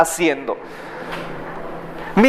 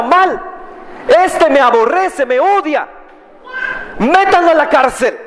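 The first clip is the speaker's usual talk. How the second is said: angry king; 9 semitones higher than usual. Acted. Ahab angrily orders the prophet jailed.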